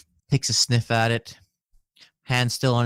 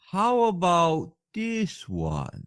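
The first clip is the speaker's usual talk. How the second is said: merchant voice; 8 semitones higher, slower than usual. Acted. Merchant voice continues.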